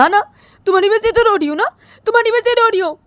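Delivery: high-pitched, wailing retort; hurt and exasperated, voice way up; tearful accusation. Acted. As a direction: throw the blame back in a high cry. Tearful accusation in acted quarrel.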